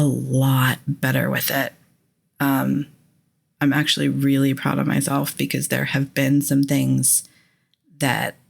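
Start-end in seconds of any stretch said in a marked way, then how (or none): none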